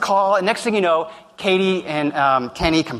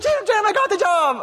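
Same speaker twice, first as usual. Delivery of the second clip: much higher, gushing news to the boss; excited intern. Retold or imitated. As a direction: blurt out the good news, thrilled. Intern Katie excitedly reports job.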